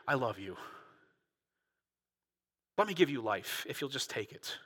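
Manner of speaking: gentle Jesus. Acted. Jesus' offer voiced softly.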